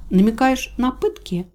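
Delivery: high-pitched challenging question; defiant. A narrator's reading. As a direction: throw the question back as a challenge. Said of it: "Я с вызовом выпрямилась".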